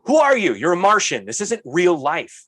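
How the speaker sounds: high, loud outburst of disbelief; exasperated, high-pitched protest; incredulous. Retold or imitated. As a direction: exclaim as if confronting an alien, slowing at the end. Own incredulous reaction to manager.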